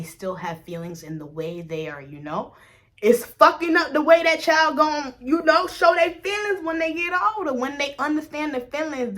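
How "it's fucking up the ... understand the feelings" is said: loud, high, fired-up outburst; heated rant. Real, not acted. Venting to viewers about careless parents, loud and heated. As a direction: let it rip in frustration.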